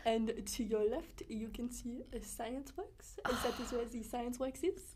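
slow, soft mock tour guide. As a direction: narrate it like a bored guide. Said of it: "I'm like".

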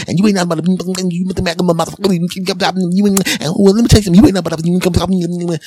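high, heated trash talk; angry insult. Retold or imitated. Lady A insulting Lady B, high and heated. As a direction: spit the insult out. Cashiers insulting each other.

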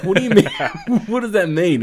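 high, loud laughing protest; indignant disbelief. Retold or imitated. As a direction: act baffled and offended, through laughter. Retells his indignant reply to brother.

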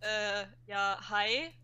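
listless. Retold or imitated. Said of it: "oder so lustlos eher so".

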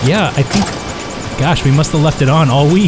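shouting. Pretend. Skit, yelling over loud machine.

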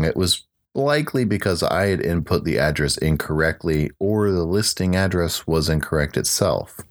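ordinary talk

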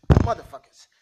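loud sudden curse; angry outburst. Acted. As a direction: spit it out in fury. Cursing the feds for the bug.

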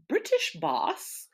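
put-on high, slow posh British accent on British boss; a camp posh British accent, stretched slowly. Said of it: "posh British accent".

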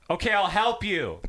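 high, slow mock hollering; imitated yell. Lady helping old man, imitated yelling. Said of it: "this lady yelling at this dude".

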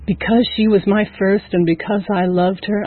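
plain reading voice

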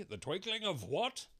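high, slow king; pompous royal question. A narrator's reading. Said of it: "said the king".

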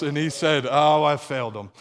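loud, laughing words; amused and loud. Real, not acted. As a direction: still laughing through the line. Laughing after the punchline.

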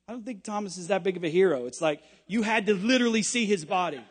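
ordinary talk

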